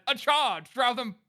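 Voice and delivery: high, hammed-up commander's cry; mock battle order. Reading a listener's comment as a commander, mock battle cry. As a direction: order the charge in mock heroics. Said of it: "Sir Spaceman said".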